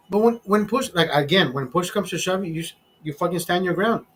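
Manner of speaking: normal talking voice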